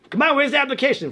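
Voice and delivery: high, fast, lightly voiced hounding teacher; nagging teacher. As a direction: hurry the kid along. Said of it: "It's like".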